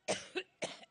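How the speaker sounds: rough coughing from the chest; chesty cough. Real, not acted. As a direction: cough hard, deep in the chest. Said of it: "I get allergic".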